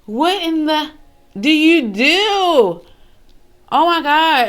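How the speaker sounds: loud, high, drawn-out astonishment; shocked disbelief. Real, not acted. Reactor hears a creepy child story, loud shocked disbelief. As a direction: exclaim it high and stretch it out in shock.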